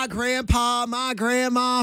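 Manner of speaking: Cheesehead Bucky. Retold or imitated. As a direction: mimic a caller's Southern twang. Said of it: "from, from, uh, Cheesehead Bucky?".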